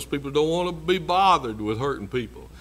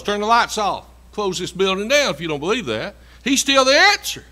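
preaching at full force; 2 semitones higher, 6 dB louder than usual. Acted. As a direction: hammer it home to the pews. Preacher building to climax.

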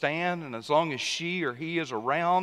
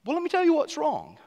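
much higher, half-yelled comeback; mock angry retort; 11 semitones higher than usual. Husband snapping back at his wife, pitched much higher.

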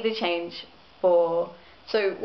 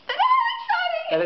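much higher and louder, a thrilled squeal; excited squeal; 4 dB louder than usual. Real, not acted. Counting down minutes to her own birthday, squealing with excitement. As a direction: squeal it with glee.

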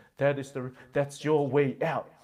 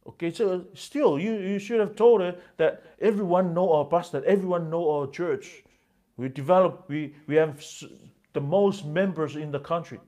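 indignant husband; 5 semitones higher, 6 dB louder than usual. Retold or imitated.

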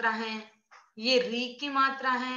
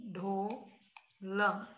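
slow demo; 4 semitones lower, 7 dB quieter, much slower than usual. Shown as a demonstration. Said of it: "हम लिखते हैं ढोल".

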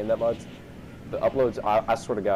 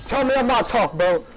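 high, loud victorious crowing; triumphant boasting; 10 semitones higher, 4 dB louder than usual. Real, not acted. Gamer demands credit for winning, triumphant boasting. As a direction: crow at full voice, pitched way up.

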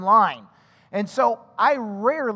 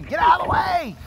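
mock scream; 7 semitones higher than usual. Son on his bike yells to clear the path, high shout. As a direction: shout it like a kid wobbling on a bike. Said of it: "You missed the scream".

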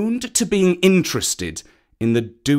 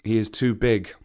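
ordinary delivery

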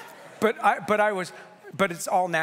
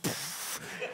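breathy poof; 3 semitones lower, 9 dB quieter than usual. Retold or imitated. Mimicking SEALs bursting in.

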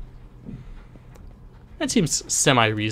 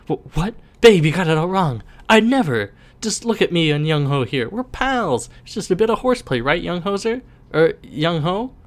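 panicked pleading; 5 semitones higher than usual. Acted. Bully pleading with girlfriend.

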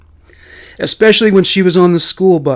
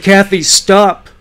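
slow, raised, pleading cry; desperate plea; 4 semitones higher, 3 dB louder, slower than usual. Acted. Teacher begging an attacking pupil, slow raised plea. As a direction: stretch it out, begging her to stop.

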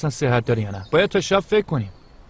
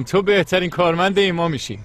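normal talking voice

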